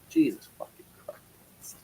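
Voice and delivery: slow, breathy whispered curse; whispered exasperation. Real, not acted. Teased by chat about his persona, slow whisper. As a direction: mutter it under your breath, exasperated.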